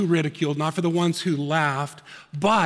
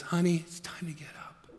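soft whispered wake-up call; gentle hushed honey; tender whisper; 8 dB quieter than usual. Acted. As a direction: whisper it like waking a child. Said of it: "He just says".

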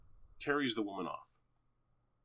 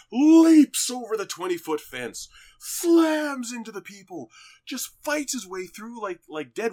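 much higher and louder, slowed down; excited; 12 semitones higher, 12 dB louder than usual. Real, not acted. Hyped, describing the fight scene to a friend. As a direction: sell the action like it's the best scene ever.

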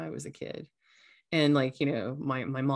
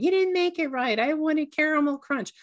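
much higher, louder entitled whine; Karen complaining; 11 semitones higher, 5 dB louder than usual. Retold or imitated. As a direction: demand the manager, shrill complaint. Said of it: "a Karen standing in line going".

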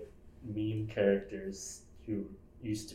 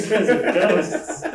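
laughing; 14 semitones higher, 13 dB louder than usual. Real, not acted. Repeating the joke, cracking up.

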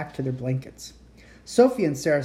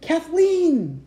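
high, loud call across a cavern; excited call of a friend's name; 6 dB louder than usual. Acted. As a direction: call her name from far away. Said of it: "Then Annie called".

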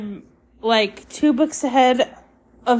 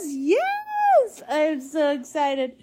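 excited; 5 semitones higher, much slower than usual. Real, not acted. Celebrating a hundred subscribers.

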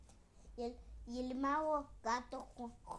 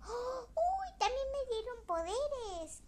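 very high, louder squeals of discovery; thrilled surprise; 11 semitones higher, 5 dB louder, slower than usual. Acted. Princess discovering she got magic powers, high excited squeals. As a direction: squeal with amazement at new powers.

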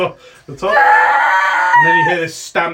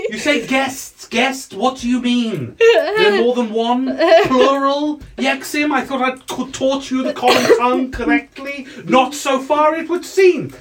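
raised-pitch, exasperated wizard; irritable wizard master; 10 semitones higher than usual. Acted. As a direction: scold your gnome servant as a crotchety wizard.

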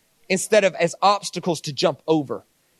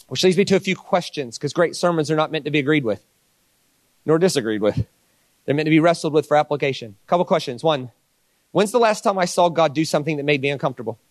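ordinary talk

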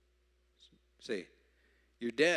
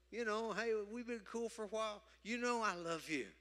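much higher, breezy protest; casual pleading Peter; 8 semitones higher, 5 dB quieter than usual. Retold or imitated. Peter to Jesus, much higher and breezy. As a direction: play it off like old buddies. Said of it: "Peter was saying".

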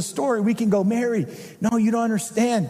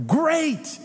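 sarcastic great; 7 semitones higher than usual. Retold or imitated. Mary's sarcastic reaction.

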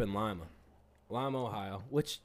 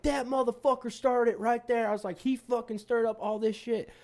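retold accusing yell; 12 semitones higher, 4 dB louder than usual. Accusing the guy to bouncer.

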